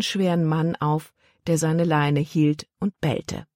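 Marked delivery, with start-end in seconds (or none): none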